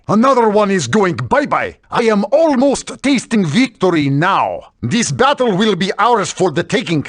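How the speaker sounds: boastful Russian brute in broken English; thick Russian accent, gloating over beaten enemies. Acted. Russian fighter taunting foes in thick Russian accent.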